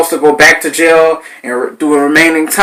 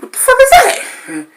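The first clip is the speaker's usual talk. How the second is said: stunned disbelief. Retold or imitated. Retold disbelief at coworkers.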